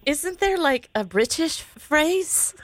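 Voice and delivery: high-pitched put-on British accent; bad British accent. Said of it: "do the British voice when you say".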